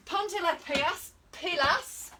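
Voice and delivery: high, loud Spanish rallying call, last word repeated; shouted Spanish. Shown as a demonstration. Instructor rallying viewers to start exercising, shouted. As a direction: shout it with enthusiastic, emotional Spanish gusto. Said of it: "I don't know why I have to shout it".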